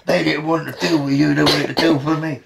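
normal talking voice

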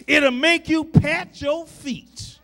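loud, rising preacher's cry; full-voiced gospel preaching; preaching at full force. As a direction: preach it out to the congregation. Preacher building to a shout.

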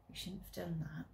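soft, cold reproach; quiet rebuke. Acted. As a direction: scold him quietly. Angry father scolds quietly.

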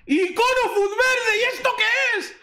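very high-pitched, stretched-out scream; ecstatic disbelief. Real, not acted. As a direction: shriek in amazement at a huge pull. Screams at a second icon pull. (chillando de pura incredulidad)

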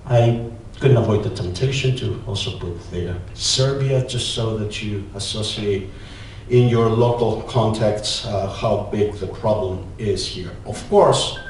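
ordinary talk

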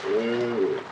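normal talking voice

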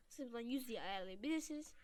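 ordinary delivery

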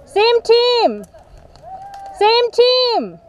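high urgent callout; shouting warning. Real, not acted. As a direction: yell it at the shooter. Being shot by a teammate.

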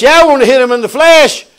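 defiant preaching. Real, not acted. Preacher admitting he wanted to hit him, loud defiance. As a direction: fire back loudly at the critic. Defiant reply to imagined critic.

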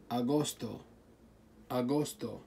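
ordinary talk

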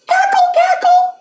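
very high-pitched hen cackle; clucking hen. A narrator's reading. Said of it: "she clucked and laughed".

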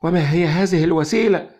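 high, loud bitter yell; distressed girl shouting her demand; bitter cry. A narrator's reading. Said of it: "صرخت في مرارة".

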